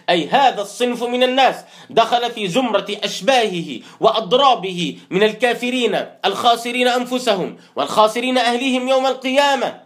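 ordinary delivery

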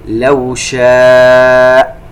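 شاء with the aa held four beats, slow and full; chanted شاء held long; long madd. Shown as a demonstration. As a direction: draw out شاء. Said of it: "كلمة شاء فيها مد متصل".

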